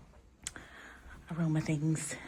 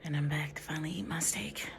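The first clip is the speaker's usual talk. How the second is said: whispering; 5 dB quieter than usual. Real, not acted. Whispering back in room.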